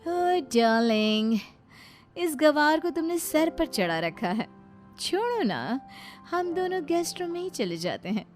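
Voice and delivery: high-pitched, demanding second wife; haughty new wife. Acted. New wife demanding the bedroom from her husband, high and haughty. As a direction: complain in a high, entitled voice.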